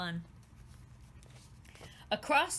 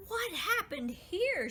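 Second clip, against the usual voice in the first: high, slow, puzzled; a small pixie puzzling over the crushed flowers; wondering aloud; 10 semitones higher, slower than usual. A narrator's reading. Pixie Nawadi at the trampled flowers, high and slow. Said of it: "she wondered aloud".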